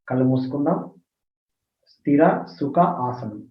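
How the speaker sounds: soft, hushed meditation guidance; quiet instructing voice for closing the eyes; guided meditation. Acted. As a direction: settle the listeners gently into stillness.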